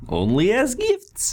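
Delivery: loud high festive barker call; booming sing song welcome. Acted. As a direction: boom it out like a carnival host. Barker answers.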